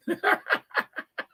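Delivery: high chuckling laugh; amused run of chuckles; real laugh. Real, not acted. Amused while reading comments.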